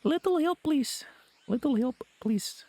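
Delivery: put-on high-pitched Russian-accented plea. Streamer trying out the hunter's voice, high Russian accent.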